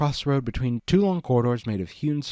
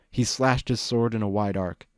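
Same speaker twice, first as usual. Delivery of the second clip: usual speaking voice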